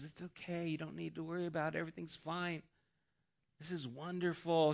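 soothing hush. Retold or imitated. Her blissful side soothing her, very soft and hushed. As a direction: coax yourself that all is fine.

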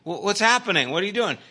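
high, loud alarmed questioning; startled asking. Retold or imitated. Speaker asking sleepwalking coworker, high alarmed questioning. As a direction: ask a friend what on earth he's doing. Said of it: "I go".

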